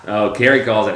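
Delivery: usual speaking voice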